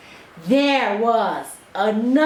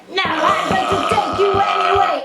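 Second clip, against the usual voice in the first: bossy ogre shout; 5 semitones higher, 3 dB louder, much faster than usual. Acted. Ogre grabs the princess.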